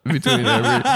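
normal talking voice

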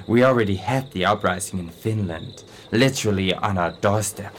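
ordinary delivery

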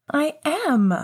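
put-on high, eager kid voice; eager little boy. A narrator's reading. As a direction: answer like a keen little boy ready to play. Child answers eagerly.

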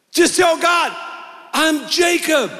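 rally them to own it; urgent shout. Real, not acted. Preacher urging the church to confess to God, shouting.

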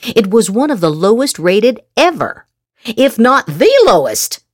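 usual speaking voice

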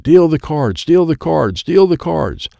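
high, insistent repeated yell. A narrator's reading. Shark's voice inside the child's head, yelling commands. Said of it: "It is yelling real loud".

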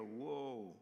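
drawn-out, slow mock whoa; balking refusal. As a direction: back away from the request, humming a no. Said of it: "and we go".

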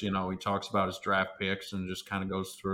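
light mock Southern drawl on the words; southern drawl imitation. Mimicking Bean talking about his draft picks, light Southern drawl. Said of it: "Kinda talks like this".

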